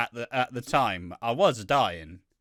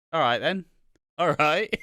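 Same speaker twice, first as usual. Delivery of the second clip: usual speaking voice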